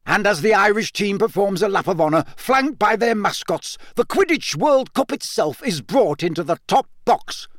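high, booming stadium commentary; roaring announcer. A narrator's reading. Bagman announcing the cup's arrival, roaring. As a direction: roar it out to a whole stadium. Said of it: "roared Bagman".

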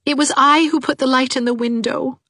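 high, small confessing voice; shamefaced. A narrator's reading. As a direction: confess sheepishly, pitched right up. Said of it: "said Anne shamefacedly".

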